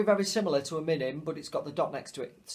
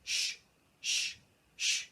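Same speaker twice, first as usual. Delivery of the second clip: breathy sh on each rest beat; whispered shush; 5 dB quieter, slower than usual. Shown as a demonstration. Teacher showing the crotchet rest with a shush. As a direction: hush it out like a silent beat. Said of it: "we've got the shush".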